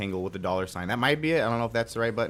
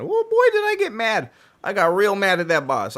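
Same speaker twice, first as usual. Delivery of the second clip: big high yelling burst, much higher and louder; mock mad yell; 10 semitones higher, 9 dB louder than usual. Streamer telling chat about raging at the boss, hammed-up yell. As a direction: blow up like reliving the rage.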